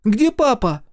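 put-on high, loud boy's demand; urgent little-boy question; alarmed child. A narrator's reading. Микки asks mother for father, alarmed.

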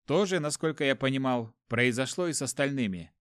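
ordinary delivery, nothing marked

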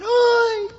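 high, loud mock scream; imitated cry out. Preacher imitating the disciples screaming to wake Jesus, crying out. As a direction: yell it like someone crying for help. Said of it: "Y gritaban".

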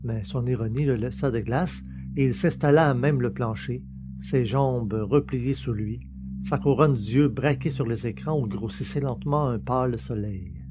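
ordinary delivery

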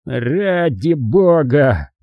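very slow, drawn-out plea; long exasperated groan of words; pained exasperation. A narrator's reading. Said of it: "поморщился Джайлс".

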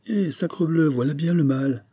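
a father crying out in exasperation; exclaiming. A narrator's reading. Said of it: "s'écria monsieur Charlemont".

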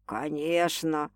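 low, soft, slow assent; tender drawn-out yes; smiling reassurance. A narrator's reading. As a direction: reassure her gently with a smile. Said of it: "улыбнулся мужчина".

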